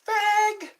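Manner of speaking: high, loud drawn-out mock yell; imitated kid's yell. Kid riding by a man's house, imitated yell. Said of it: "the kid yells".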